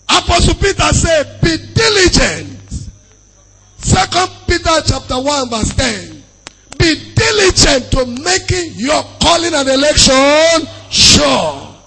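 high, forceful preacher's delivery, key words stretched; full-force preaching. Real, not acted. Preacher urging the congregation to be sure of salvation, full-force preaching. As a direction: proclaim scripture with long, ringing emphasis.

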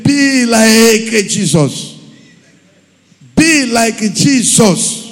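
Be like Jesus said slow and loud, stress on Be; emphatic slow exhortation. Acted. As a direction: drive each word home like a preacher. Preacher exhorting congregation.

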